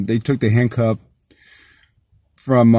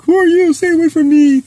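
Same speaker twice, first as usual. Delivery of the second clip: much higher, louder hammed-up frightened cry; mock horror victim; 7 dB louder than usual. Goofing for a silly horror clip, hammed-up high frightened cry. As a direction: play the screaming victim in a cheap horror film. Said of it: "stupid horror movie".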